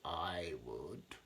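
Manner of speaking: a lightly creaking coral voice; creaky coral. A narrator's reading. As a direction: creak it out like crusty old coral. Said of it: "creaked a crusty coral".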